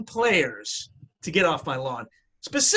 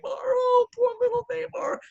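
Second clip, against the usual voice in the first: high, mocking baby-talk pity; 13 semitones higher than usual. Mocking the rival players consoling Neymar, sarcastic coo. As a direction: coo at him like a pitied child.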